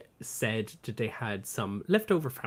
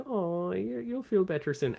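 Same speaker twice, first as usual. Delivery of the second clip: gentle comforting voice; 6 semitones higher than usual. Retold or imitated. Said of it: "Little Red was like".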